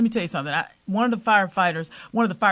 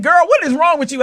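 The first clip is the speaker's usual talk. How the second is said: exasperated father; 9 semitones higher, 6 dB louder than usual. Retold or imitated. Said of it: "He said".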